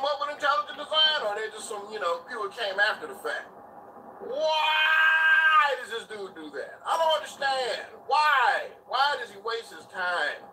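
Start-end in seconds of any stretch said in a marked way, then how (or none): 4.2-10.4 slow, crying-out shout of exasperation; frustrated rant. Real, not acted. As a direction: vent it, almost pleading. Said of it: "I just wanna yell and rant".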